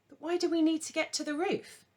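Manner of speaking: put-on a girl who doesn't get the plan; puzzled young girl. A narrator's reading. Agnes questioning, high voice.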